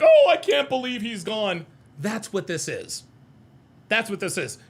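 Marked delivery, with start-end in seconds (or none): 0.0-1.6 loud mock wail of loss; hammed-up, badly faked heartbreak; fake grief. Streamer imitating the Riddler faking shock at a death, mock grief. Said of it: "what they would normally sound like".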